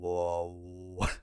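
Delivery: very low, droning didgeridoo-like voice; playful low bass-singer imitation; imitating low singer. Shown as a demonstration. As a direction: drop down low and drone like a didgeridoo. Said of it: "I feel like I could do that noise".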